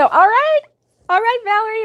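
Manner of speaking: high, loud, delighted whoop of approval; excited cheer. Real, not acted. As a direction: cheer on a fellow enthusiast, pitch way up. Cheering a viewer who shares her habit.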